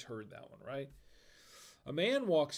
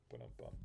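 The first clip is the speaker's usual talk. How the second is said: low mumbled ba-dum-bum, much lower and softer; mouth rimshot; 15 dB quieter, faster than usual. Pretend. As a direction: hum the drum sting under your breath. Rimshot after a joke.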